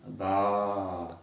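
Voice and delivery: BA with a long, sustained A; long vowel. Shown as a demonstration. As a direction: draw out the A of BA. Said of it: "prolongez et répétez avec moi". (BA avec un a prolongé)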